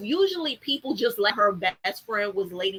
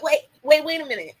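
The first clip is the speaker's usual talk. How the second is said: flabbergasted; 5 semitones higher, 4 dB louder, faster than usual. Retold or imitated. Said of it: "Eloise was flabbergasted".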